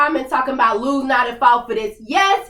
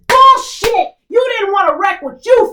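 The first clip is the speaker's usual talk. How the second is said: angry shout; 6 semitones higher, 6 dB louder than usual. Real, not acted. Reactor yelling at Lou's excuse, angry shout. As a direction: bark it out loud and higher.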